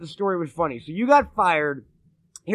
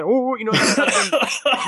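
higher, drawn-out comic moan; Richard Pryor; 4 semitones higher, slower than usual. Retold or imitated. Podcaster mimicking his friend's phone call, Pryor-style moan. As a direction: imitate a worked-up stand-up comic. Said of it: "he sounded like Richard Pryor doing that".

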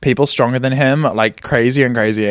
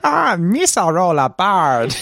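Gungan voice; 4 semitones higher, slower than usual. Pretend. Laughing, Gungan-style voice.

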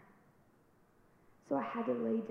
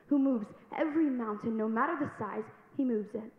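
louder, forceful sermon delivery; preaching; 2 semitones higher, 6 dB louder than usual. Acted. Exhorting the congregation about faith, full force preaching. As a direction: drive it home like a preacher.

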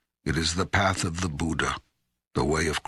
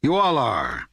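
loud, high, then falling hard; emphatic accusation; 8 semitones higher, 5 dB louder than usual. Acted. God answering the questioner's challenge, emphatic accusation. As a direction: throw the accusation straight back at them.

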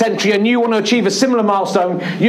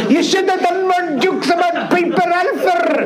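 put-on Glaswegian accent; 11 semitones higher, slower than usual. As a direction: mimic the Glaswegian man's exact accent, drawn out through laughter. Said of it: "in this exact accent said to me".

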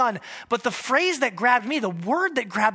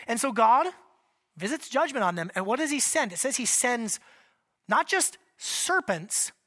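ordinary delivery

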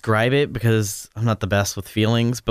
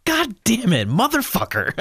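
mock cursing; 11 semitones higher than usual. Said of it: "I'm like".

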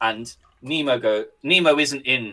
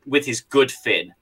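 ordinary talk, nothing marked

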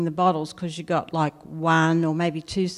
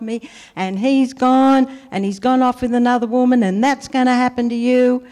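retold screaming rant; 3 semitones higher than usual. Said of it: "she was screaming at me".

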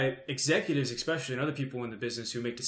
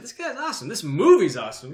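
excited; 5 semitones higher, 6 dB louder than usual. Retold or imitated. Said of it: "I was like".

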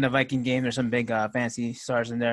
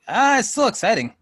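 excited; 9 semitones higher, 5 dB louder than usual. Real, not acted. Insisting football still exciting.

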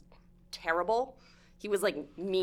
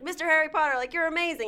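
higher, awed fan greeting; fawning stranger; 10 semitones higher, 5 dB louder, faster than usual. Retold or imitated. Cloaked stranger bowing to Harry, higher and awed. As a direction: gush up at him starstruck. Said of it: "and he's like".

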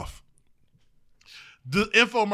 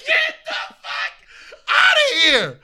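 much higher, shouted through laughter; excited shout. Retold or imitated. Retelling his reaction to the lady, laughing shout. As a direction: yell it like you can't believe it.

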